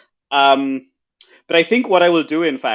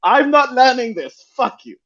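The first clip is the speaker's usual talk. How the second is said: high outburst; 9 semitones higher than usual. Acted. Possessed dancer refusing to learn.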